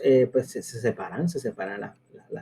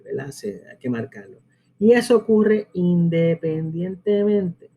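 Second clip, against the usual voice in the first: ordinary talk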